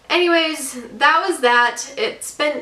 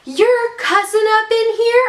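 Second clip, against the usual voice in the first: gasp, then high, loud, drawn-out outrage; mock scandalized shock; 11 semitones higher, 5 dB louder than usual. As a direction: imitate an appalled agent, pitch way up. Acting out a shocked gate agent.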